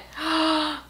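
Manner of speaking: quiet delighted gasp; soft gasp. Real, not acted. Soft gasp as the mandrake comes out of its pot. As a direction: catch the breath in wonder.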